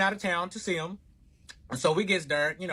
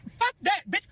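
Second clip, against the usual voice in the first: high-pitched furious tirade; re-enacted screechy argument at full tilt; livid; 9 semitones higher than usual. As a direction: rage at him and shove him away with the words.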